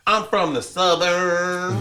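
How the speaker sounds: high, drawn-out mock Southern drawl; mock Southern accent. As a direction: stretch it into an exaggerated Southern twang. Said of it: "they would be like".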